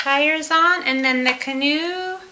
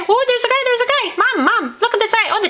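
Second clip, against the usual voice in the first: put-on excited kid voice; 7 semitones higher, faster than usual. Voicing excited Lego kid.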